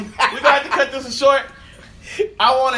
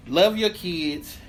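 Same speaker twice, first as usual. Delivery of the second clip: slow sincere plea; 6 semitones lower, 4 dB quieter, much slower than usual. Real, not acted. Host's closing message.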